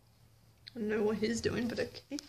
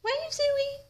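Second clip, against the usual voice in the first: high sing-song prompting; coaxing pet talk; 7 semitones higher, much slower than usual. Real, not acted. Prompting the pet to wave, sing-song coaxing. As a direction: coax an animal up high.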